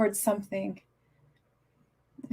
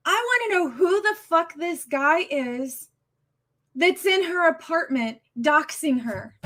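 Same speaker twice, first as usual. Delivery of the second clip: higher, angry demand; 6 semitones higher than usual. Real, not acted. Streamer raging at whoever filmed her friend, angry demand. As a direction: demand an answer, fired up. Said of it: "man, I'm angry".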